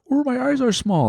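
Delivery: high-pitched mocking quote; mocking retold one-liner. Co-host replaying the other's bad joke, mocking high voice. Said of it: "You were just like".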